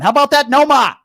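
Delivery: loud, high Boston shout-out; Boston accent. Acted. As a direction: call it out in a thick mock Boston accent. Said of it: "that was my Boston accent".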